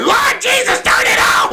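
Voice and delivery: put-on high shrill-pitched outraged old lady; high mock yell; grandma shouting. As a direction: shout to heaven like a fed-up grandma. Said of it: "She's like".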